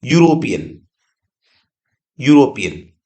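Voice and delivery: यूरोपियन said as "yoo-ro-pi-an", starting with a yoo sound; यूरोपियन spoken clearly as yoo-ropian; pronouncing European. Shown as a demonstration. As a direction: show that यूरोपियन begins with a yoo sound, not e. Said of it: "लेकिन इसका उच्चारण देखो".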